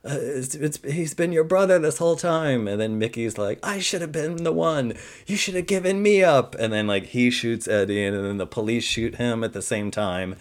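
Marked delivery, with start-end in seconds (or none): 0.1-2.7 mildly dramatic plea, pitched high; acted pleading. Mother begging her son not to shoot his brother, acted pleading. 3.6-6.6 high, melodramatic lament getting louder; mock anguished outburst. Son reproaching his mother with gun in hand, hammed-up anguish.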